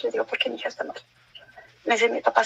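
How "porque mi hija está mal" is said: softer whispered answer; quiet worried voice to her father; hushed worried reply. Retold or imitated. Telling father, unvoiced, quiet.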